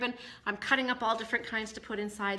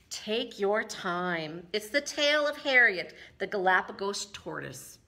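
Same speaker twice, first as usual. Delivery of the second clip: plain reading voice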